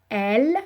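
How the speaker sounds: Elle said as one syllable; sounding out words. Shown as a demonstration. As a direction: give Elle on its own. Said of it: "syllabe par syllabe".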